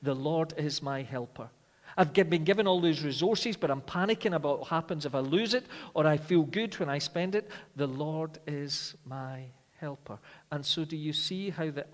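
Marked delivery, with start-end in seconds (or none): 2.0-7.5 rising, louder preaching, words pushed out with urgency; full-force preaching. Acted. Preacher pressing duties on the church, full-force preaching. 7.8-10.2 "The Lord is my helper" said very slowly, each word weighted; slow, weighty refrain. Shown as a demonstration. Preacher closing the refrain for the church, slow and weighty. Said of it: "refrain repeated, final take slowed".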